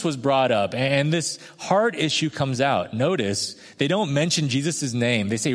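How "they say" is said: ordinary talk, nothing marked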